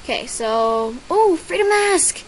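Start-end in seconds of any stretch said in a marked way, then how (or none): 1.1-2.2 high, loud delighted cry; thrilled at the gift; excited squeal. Real, not acted. Reading a new item, a delighted squeal.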